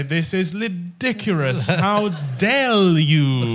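slow, drawn-out mock indignation, L and R sounds swapped; imitating mixed-up L's and R's. Author imitates the sitcom character's swapped L and R, mock outraged. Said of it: "So she'd say".

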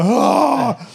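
high, loud startled "ah!"; high retold scream; scared scream. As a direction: shriek in alarm at the stranger. Family screams in fright.

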